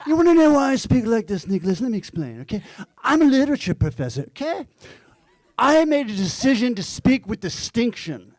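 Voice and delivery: a literature professor defending his Mid-Atlantic speech, quick and high; father's Mid-Atlantic accent. Retold or imitated. Father answering Nicholas, in a high, fast Mid-Atlantic accent. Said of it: "he always spoke with distinction".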